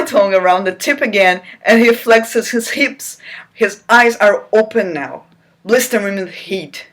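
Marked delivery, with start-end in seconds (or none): none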